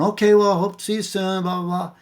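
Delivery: high, sing-along mock wife brushing you off; breezy brush-off. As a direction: imitate a wife blithely brushing off a caller. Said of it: "she was just playing me off".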